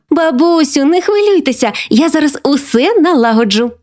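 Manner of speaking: high-pitched helpful granddaughter; eager exclamation. A narrator's reading. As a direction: reassure grandma with childish eagerness. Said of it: "вигукнула вона". (бадьорий дитячий вигук)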